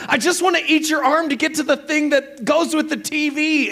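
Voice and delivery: very high, urgent retold plea; acted yelling. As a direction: act out a frantic half-asleep husband. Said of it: "You are screaming".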